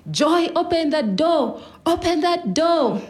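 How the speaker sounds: high, drawn-out acted yell; retold screaming. Brothers join in at mom's door, screaming. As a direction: yell the name, then hammer out the order.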